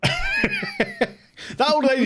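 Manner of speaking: very high, slow, laughing; laughing squeal. Real, not acted.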